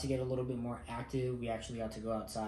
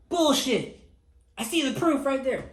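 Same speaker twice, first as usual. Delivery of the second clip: much higher, much louder mock instructor shout; imitated instructor shout; 13 semitones higher, 11 dB louder than usual. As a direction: blast the accusation like a furious instructor. MTI shouting accusation.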